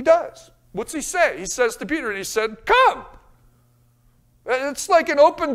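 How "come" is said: high, loud inviting call. Acted. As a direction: call him out onto the water. Jesus calling Peter out.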